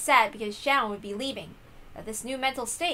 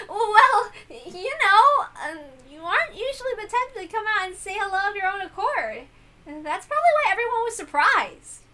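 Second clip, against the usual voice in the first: a higher, brighter-pitched girl voiced lightly; lively girl; 8 semitones higher than usual. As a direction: chatter to him a bit flustered. Jessica's voice.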